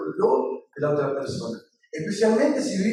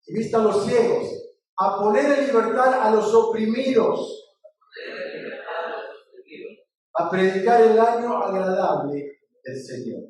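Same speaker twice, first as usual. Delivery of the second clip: scripture recitation; 3 semitones higher than usual. Acted. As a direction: proclaim it phrase by phrase for the congregation to repeat.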